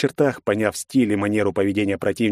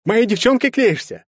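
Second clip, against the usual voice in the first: much higher, louder jealous accusation; guarding his girl, furious; jealous snarl; 13 semitones higher, 4 dB louder than usual. A narrator's reading. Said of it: "зарычал Герцен". (ревниво, зло)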